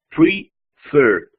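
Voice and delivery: "prefer" separado en "pre ... fer"; dividido en sílabas. Shown as a demonstration. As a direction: silabear "prefer" con una pausa en medio. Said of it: "Dos sílabas". ("prefer" en dos golpes, "fer" alargado)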